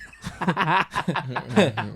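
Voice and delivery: big real laugh; loud laugh. Real, not acted. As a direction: burst of high laughter. Laughing while telling story.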